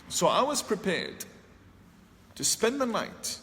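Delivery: ordinary talk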